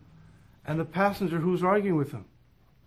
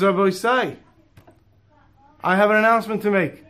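raised voice to address the bus; a public announcement to the whole bus; calling out announcement; 3 semitones higher, 5 dB louder than usual. Retold or imitated. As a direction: stand up and call out to everyone. Passenger stands to announce.

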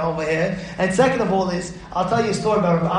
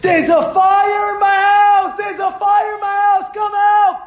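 high, loud, drawn-out alarm cry; screaming for help; 12 semitones higher, 5 dB louder, slower than usual. Retold or imitated. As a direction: yell as if the house burns. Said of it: "He screams on top of his lungs".

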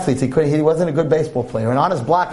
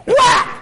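high loud shouted whack; whack sound; 4 dB louder than usual. Retold or imitated. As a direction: shout the bat's impact. Hitting God metaphor sound.

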